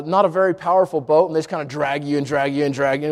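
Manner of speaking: usual speaking voice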